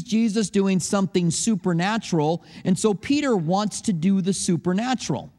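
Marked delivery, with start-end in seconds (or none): none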